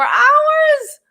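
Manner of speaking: loud, high, proud boast; excited shout. Real, not acted. Telling viewers about her sales in an excited shout. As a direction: shout the number like a win.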